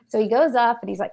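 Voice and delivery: ordinary delivery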